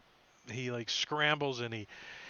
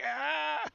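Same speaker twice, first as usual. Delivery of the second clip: sudden high yelp of pain; a shrill creature cry as the arrow hits; pained squawk; 13 semitones higher than usual. Acted. Injured bird-creature yelping at the party, high pained squawk.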